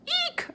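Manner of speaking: very high tiny eek of fright; frightened hamster eek; startled squeak. A narrator's reading. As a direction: squeak in sudden alarm. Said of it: "I squeaked".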